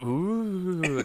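high, long "ooh"; mock ghostly moan. Arguing it sounds nothing like Chris, high mock ghostly moan. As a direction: hoot it like a pretend ghost. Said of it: "doesn't sound like Chris".

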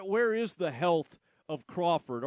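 ordinary delivery

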